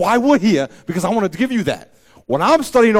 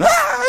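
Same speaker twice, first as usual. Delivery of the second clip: mock high yelp; 4 dB louder than usual. Mock exclamation.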